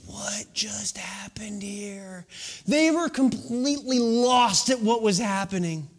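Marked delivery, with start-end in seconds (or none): 0.0-2.2 slow, breathy whisper of disbelief; dad and brother baffled by mom's dance, whispered; stunned whisper. Retold or imitated. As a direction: hush it like you can't believe your eyes. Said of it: "looked at her like".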